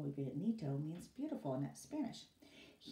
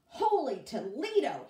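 loud, high shocked cry; shocked exclamation; 7 semitones higher, 10 dB louder than usual. Acted. Boss sees ruined garden, loud shocked exclamation. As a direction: blurt it out in disbelief.

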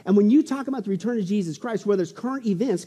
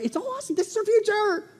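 very high, strained-up squeal of joy; thrilled exulting; 14 semitones higher than usual. Real, not acted. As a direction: let the excitement lift the pitch right up. Exults over the promised future.

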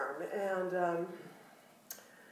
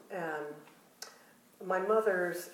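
normal talking voice

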